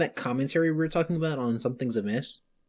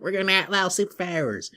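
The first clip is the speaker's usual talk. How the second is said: a hint of a Southern lawman; Southern-accented mock decree; 5 semitones higher than usual. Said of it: "they said it in a Southern accent".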